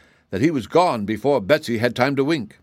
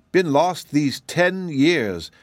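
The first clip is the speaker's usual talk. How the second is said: sad, slow; 6 semitones higher, much slower than usual. Acted. Shaggy wiping a tear.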